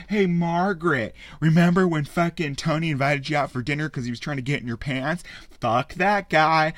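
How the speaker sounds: loud, high, hammed-up bar toast; rally the room like a smug showman raising a glass; booming mock toast to a crowd of grudge-holders; mock Mysterio toast. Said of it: "he starts giving this, like, whole fucking spiel".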